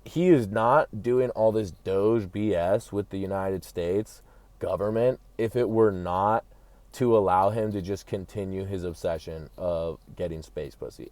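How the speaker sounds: ordinary delivery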